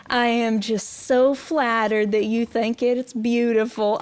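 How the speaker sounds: usual speaking voice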